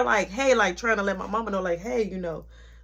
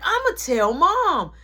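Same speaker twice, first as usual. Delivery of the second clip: whiny little brother; 10 semitones higher, 6 dB louder than usual. Retold or imitated. Little brother threatens to tell.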